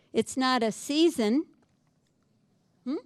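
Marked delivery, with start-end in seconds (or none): none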